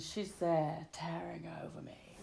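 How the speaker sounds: dropping into a near-whisper; soft, whispered storytelling aside; hushed suspense. Retold or imitated. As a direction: hush it as the looming figure appears.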